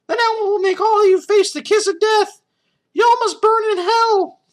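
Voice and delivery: very high, loud falsetto puppet threat; screeching puppet tyrant, full volume; Elmo villain voice. Acted. Evil Elmo threatening all the interns, shrill loud falsetto. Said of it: "Then he said something that shocked us".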